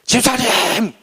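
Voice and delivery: a laughing, half-hammed shout of the name; mock yell. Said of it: "침 다 튀겨가면서 막".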